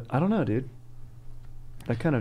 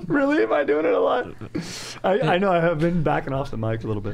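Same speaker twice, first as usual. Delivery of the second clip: laughing; 7 semitones higher than usual. Real, not acted. Laughing, flustered by the teasing.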